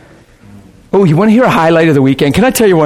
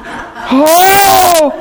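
imitating wailing; much slower than usual. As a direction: mimic a man overcome, crying out. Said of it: "He's like".

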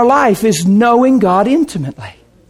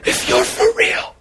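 hushed tearful plea; 10 semitones higher, 6 dB quieter than usual. Retold or imitated. Pleading to God, retold.

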